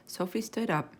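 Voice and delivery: plain reading voice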